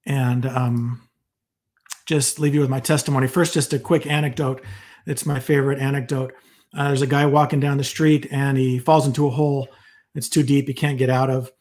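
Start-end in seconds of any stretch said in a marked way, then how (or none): none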